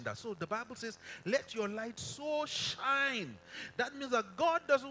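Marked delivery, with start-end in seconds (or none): 1.2-3.4 slow, raised pitch, so and shine stretched; stress on SO. Shown as a demonstration. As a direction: proclaim it high, drawing out "so shine". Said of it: "So there's an emphasis".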